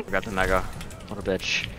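usual speaking voice

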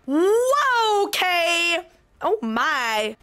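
high, drawn-out astonishment; real impressed outcry; amazed exclamation. Real, not acted. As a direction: let it swoop up high in amazement. Amazed at domain reveal.

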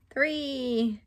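plain reading voice

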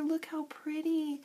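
ordinary talk

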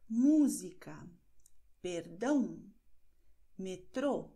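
Música hit on MÚ, Perdão on DÃO, Metrô on TRÔ; accent marks tell where the weight falls; stress on accented syllable. Shown as a demonstration. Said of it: "da ist die betonte Silbe".

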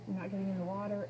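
usual speaking voice

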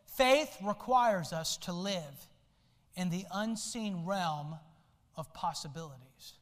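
slow, weighty reading of the quote; slow reverent delivery of the quote; slow solemn quote. Shown as a demonstration. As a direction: let each phrase sink in slowly. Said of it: "I want you to listen to this quote".

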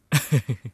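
a short quiet chuckle; soft laugh. Acted. Prince chuckling shyly over the melon field. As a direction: laugh softly to himself.

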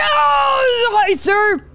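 very high, screamed greeting; startled shriek. Real, not acted. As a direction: shriek a greeting at a sudden scare. Startled by figure in game.

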